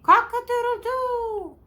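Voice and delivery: high, sustained rooster call; high cock-a-doodle-doo with a long last note; rooster crow. Shown as a demonstration. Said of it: "How does the rooster do?".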